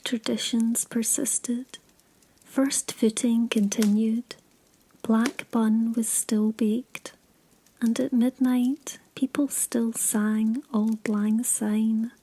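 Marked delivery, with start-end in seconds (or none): none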